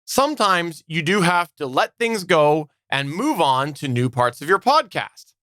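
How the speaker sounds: ordinary talk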